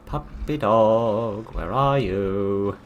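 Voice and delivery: drawn-out teasing pet call; mock coaxing call. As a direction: coax the monster like a puppy. Calling to a monster dog.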